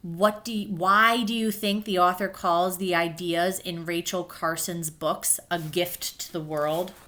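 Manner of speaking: ordinary talk, nothing marked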